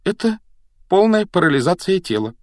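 high-voiced girl's regret; mildly plaintive explanation; sad answer. A narrator's reading. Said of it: "грустно ответила Гермиона".

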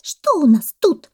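high, loud little monkey. A narrator's reading. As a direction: pipe up like a monkey. Monkey questions the parrot. (голос мартышки)

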